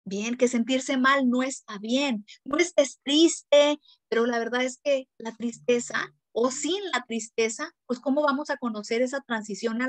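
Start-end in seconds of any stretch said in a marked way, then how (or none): 2.4-3.8 slow, louder, higher scolding adult. Retold or imitated. Parent telling a grieving child not to be sad, slow loud scolding.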